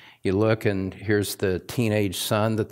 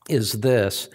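usual speaking voice

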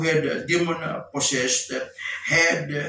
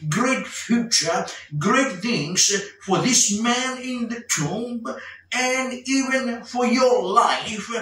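slow, higher-pitched sermon proclamation; emphatic preaching; 6 semitones higher, slower than usual. Acted. Preacher promising great things to the congregation, emphatic and slow. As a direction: preach it slowly, stretching each key word.

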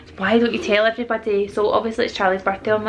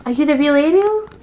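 surprised; 6 semitones higher, 3 dB louder than usual. Pretend. Mother plays amazed at doll.

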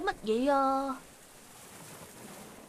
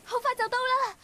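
high, panting call; out of breath, pitched high; breathless urging; 10 semitones higher than usual. Acted. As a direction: pant between words while running. Running away in a chase.

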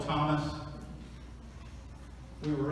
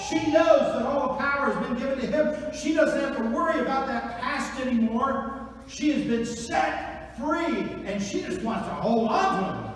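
high, louder fervent preaching; full-force preaching; 9 semitones higher, 7 dB louder than usual. Acted. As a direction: preach it with rising fire.

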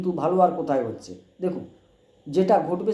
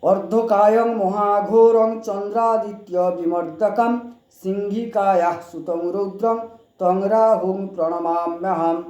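slow, louder chanted Sanskrit; mantra recitation; 3 semitones higher, 4 dB louder, slower than usual. Shown as a demonstration. Astrologer recites the Rahu mantra for viewers, slow chant. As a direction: intone the Rahu prayer slowly.

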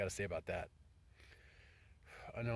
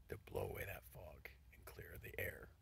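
whisper; 7 semitones lower, 13 dB quieter than usual. Real, not acted. Hoping for a fresh wind.

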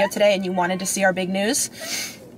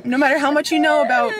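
tearful; 5 semitones higher, 5 dB louder than usual. Real, not acted. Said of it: "it's still so hard to not feel sad".

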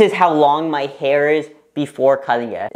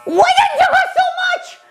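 very high, loud shriek of disbelief; real shocked shriek; 3 dB louder than usual. Real, not acted. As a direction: shriek in shock through a nervous laugh. Shocked at how much hair fell.

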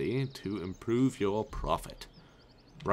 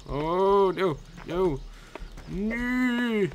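squealed no; 10 semitones higher, much slower than usual. Real, not acted. Snowball fight gone wrong.

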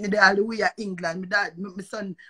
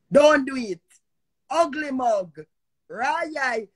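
ordinary delivery

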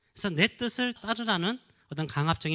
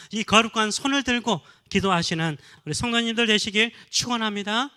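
louder, rising sermon exhortation; fervent preaching; 4 semitones higher, 7 dB louder than usual. Acted. Pastor urging fathers to surrender to God, fervent and loud. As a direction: press the fathers hard from the pulpit.

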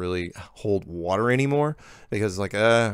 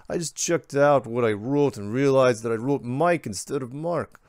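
lightly put-on Croatian accent, higher; a hint of Croatian accent; 5 semitones higher than usual. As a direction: read the email in a mild Croatian accent. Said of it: "I'm going to read this now in the accent".

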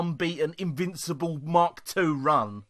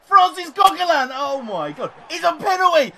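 loud, high cheering; thrilled at winning the penalty; real excited yelling; excited shout; 7 semitones higher, 8 dB louder than usual. Real, not acted. Celebrating penalty won.

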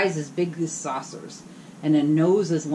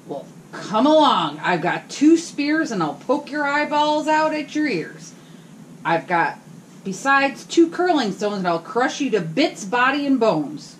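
fierce goat challenge; 7 semitones higher, 5 dB louder than usual. Acted. Big goat daring the troll, high and loud. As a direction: taunt the troll boldly.

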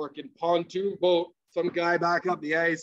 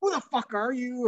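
high-pitched mock indignation; retold challenge, pitched up but not shouted; angry opponents; 6 semitones higher than usual. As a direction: imitate an outraged player confronting someone. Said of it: "a lot of angry faces".

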